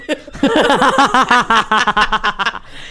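loud, long run of laughter; big laugh. Real, not acted. Storyteller amused at her own drug story, loud long laugh. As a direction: burst out in a real cackle.

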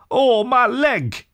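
high, slow wail of a hurt mole; slow, high yelp of pain; cry of pain. A narrator's reading. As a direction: whimper it out as if the leg really hurts. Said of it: "he cried".

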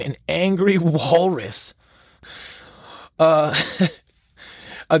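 slow "angry" breaking into a laugh; laughing through it. Real, not acted. Host rereads the walrus article, laughing mid-phrase.

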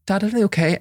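put-on a light touch of a concerned child; imitating worried kids. Children checking on their crying dad, lightly voiced.

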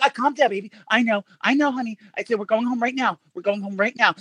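calm the fake daughter down; pretend soothing mom. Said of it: "I'm still in the character".

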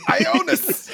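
high-pitched call of "Ionis" breaking into laughter; acted yell. Player corrects the ally's name he is calling, high yell. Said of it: "I'm gonna yell".

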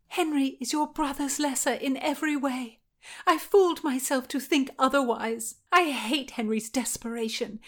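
high, vehement woman's voice; impassioned Anne. Acted. Anne declares her contempt for Henry, impassioned.

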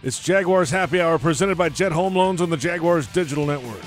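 upbeat radio announcer; announcer read. Acted. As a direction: read it like a station ID. Station sponsor announcement.

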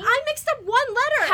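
high, loud defensive outburst; indignant protest. Acted. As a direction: blurt it out in defence. Defending in the argument.

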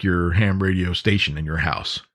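ordinary delivery

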